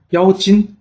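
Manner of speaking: high, loud disbelieving question; as if the claim is absurd; surprised. A narrator's reading. Bi Gan questions Jiang Ziya's claim, loud high disbelief. Bi Gan hears it's a demon.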